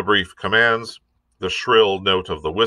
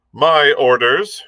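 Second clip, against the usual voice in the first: slow, clipped higher command; curt; 6 semitones higher, 5 dB louder, slower than usual. A narrator's reading. Captain curtly overrules the cook, slow and higher. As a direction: cut him off with authority.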